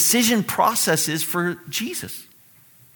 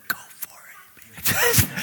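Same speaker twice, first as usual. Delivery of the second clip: hushed, urgent cheer; whispered egging on; 6 dB quieter than usual. Acted. Some of us reading this, whispering encouragement to Jesus. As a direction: whisper it like a secret supporter.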